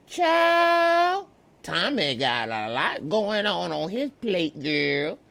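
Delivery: high, swooping Southern gossip; Southern impression. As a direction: open on a high Southern cry, then gossip away. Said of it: "I said she from the South".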